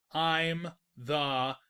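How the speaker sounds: very slow, loud, deliberate; slow emphatic declaration. Acted. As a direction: spell it out word by word.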